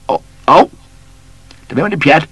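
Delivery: high, loud cry of surprise and pain; pained yelp. A narrator's reading. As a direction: yelp like you've been stung. Native yelps when hit.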